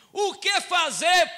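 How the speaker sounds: slow, loud and high cry of the question; preached outcry. Acted. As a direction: call it out slowly to the whole room. Pastor cries out the question.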